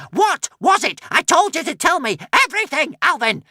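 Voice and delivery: high loud furious shriek. A narrator's reading. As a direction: claw at him screaming. Witch attacking Alvin.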